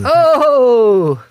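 sudden loud gasp of shock; startled burst; surprised. Real, not acted.

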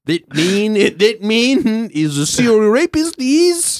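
hammed-up Australian accent; broad comic Australian accent; Hannah Gadsby. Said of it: "take anyone with an Australian accent".

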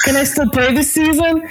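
high, hopeful asking voice; light mock of her own anxious question; asking the trainer. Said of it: "I asked her".

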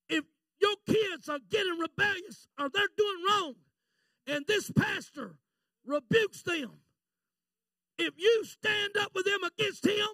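high, driving Pentecostal preacher; full-force preaching. Real, not acted. Preacher warning parents about rebellious children, full-force preaching. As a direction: punch out the warning in hard bursts.

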